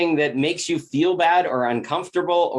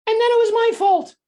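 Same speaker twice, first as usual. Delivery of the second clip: sarcastic, much higher mock voice of the accuser; mocking blame; 4 dB louder than usual. Mocking the person who blamed me, sarcastic high voice. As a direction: mimic their whiny blame, pitched way up.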